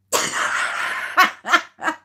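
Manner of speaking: airy burst of giggling; breathy giggle. Real, not acted. Laughing at herself after fluffing the jingle, airy giggle. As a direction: giggle at the flub.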